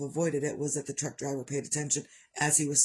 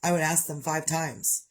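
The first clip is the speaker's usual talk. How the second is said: ordinary delivery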